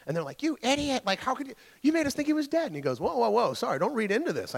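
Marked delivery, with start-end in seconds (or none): 0.4-2.7 high-pitched, indignant family scolding; mock outraged yell. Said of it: "yelled at him again". 3.0-4.5 defensive protest. Acted. Doctor backs off from angry family.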